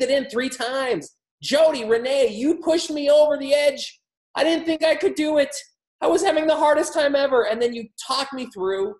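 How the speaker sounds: high-pitched, gushing stage testimonial; grateful testimonial speaker. Acted. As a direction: play an overwhelmed client praising their coach on stage. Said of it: "they say about you one day on stage".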